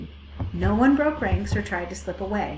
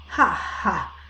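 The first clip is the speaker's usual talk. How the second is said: bleating laugh; 4 dB louder than usual. A narrator's reading. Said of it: "bleated a big lamb".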